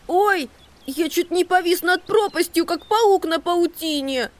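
very high, shaken mouse squeak; slow, breathless scared mouse; frightened little mouse. A narrator's reading. Maxi frightened at the cliff.

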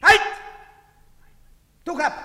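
high, abrupt order; shouted command. Acted. Soldier to a hooded man, shouted command.

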